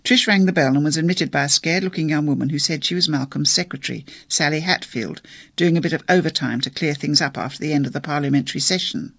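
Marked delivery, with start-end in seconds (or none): none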